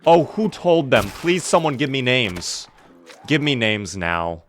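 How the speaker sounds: ordinary talk, nothing marked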